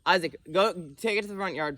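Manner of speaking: calling out. Real, not acted. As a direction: call it firmly across the yard. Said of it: "I said that three times".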